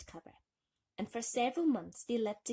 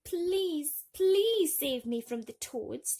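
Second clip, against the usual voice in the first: higher, slow, louder plea; pleading; 8 semitones higher, 8 dB louder, much slower than usual. Acted. Thumbelina begging the fishes, tearful plea. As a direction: beg through tears. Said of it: "she pleaded".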